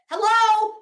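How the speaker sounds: exasperated hello to the audience; emphatic hello. Real, not acted. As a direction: say it like it should be obvious. Emphatic aside to viewers.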